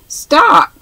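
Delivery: sharp high shout of Stop; cried out. A narrator's reading. Swiveller halting his companion at the door, loud cry. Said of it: "cried Mister Swiveller".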